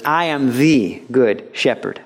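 slow line with "the" drawn out as "thee"; emphatic THE. Shown as a demonstration. Preacher voicing Jesus' claim over under-shepherds, stress on THE. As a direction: set "the" apart, stretched and stressed. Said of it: "this emphatic claim".

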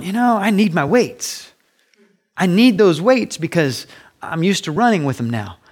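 mock protest, clinging to a crutch; protesting athlete. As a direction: plead a little, as an imagined runner. Acting out a reluctant athlete.

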